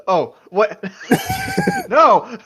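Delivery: loud, high laughing shout of disbelief; shocked laughing. Real, not acted. Player shocked by the two penises joke, laughing shout.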